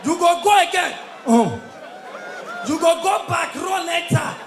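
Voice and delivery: shouted crowd hype call, each line barked out. Acted. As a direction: pump up the crowd like a party MC. MC hyping crowd with call-and-response.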